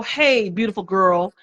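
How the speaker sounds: ordinary delivery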